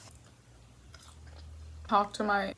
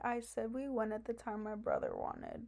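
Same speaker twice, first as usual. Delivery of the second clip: quiet; 8 dB quieter than usual. Real, not acted. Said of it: "Why did I just talk so fucking quiet?".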